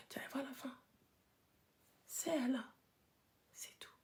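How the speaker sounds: soft unvoiced whisper; quiet whispered aside. Shown as a demonstration. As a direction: keep it to a breathy hush. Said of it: "on chuchote".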